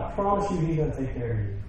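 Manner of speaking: ordinary delivery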